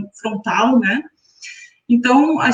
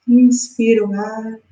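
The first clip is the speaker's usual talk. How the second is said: guided meditation. Acted. Guides breathing exercise.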